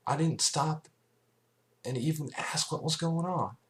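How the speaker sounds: high, earnest voice full of regret; country mare confessing her guilt, upset; remorseful Applejack. Acted. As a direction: own up to a wrong, pleading.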